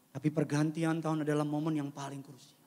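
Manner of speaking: usual speaking voice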